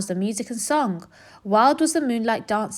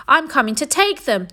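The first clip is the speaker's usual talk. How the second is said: higher, louder greedy yell; 6 semitones higher, 4 dB louder than usual. A narrator's reading. Rat yelling into the cave, greedy yell. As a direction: yell it as you rush in. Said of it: "the rat thief yelled".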